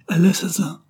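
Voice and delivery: narrated cry of alarm, high and loud; sudden high cry for help. A narrator's reading. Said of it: "il ébaucha le cri".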